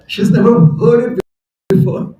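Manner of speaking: ordinary delivery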